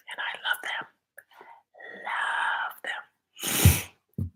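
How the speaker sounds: soft unvoiced whisper. Real, not acted. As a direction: whisper it close to the mic. Whispered praise.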